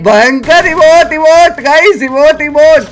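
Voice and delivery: drawn-out, high triumphant chant; gloating hype. Real, not acted. Gamer gloating to viewers with emotes, triumphant and drawn out. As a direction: stretch each word out in gleeful taunting.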